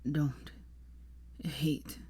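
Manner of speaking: low, soft, halting murmur; dying man's hushed, low voice; weak whisper. A narrator's reading. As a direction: murmur it softly with long gaps. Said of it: "he whispered".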